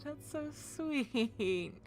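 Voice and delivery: slow, moved aw with a catch of laughter; real, slow touched thanks; touched and grateful. Real, not acted. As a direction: melt at the kind words, close to tears. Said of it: "I'm gonna start crying".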